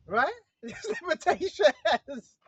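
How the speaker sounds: slow, words broken up by laughter; laughing. Real, not acted. Preacher amused by Satan's limits, laughing while speaking. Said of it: "I say I'm laughing because I'm thinking about it".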